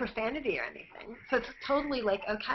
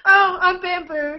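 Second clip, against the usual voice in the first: mock wail; 7 semitones higher, 7 dB louder, slower than usual. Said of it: "mock lament".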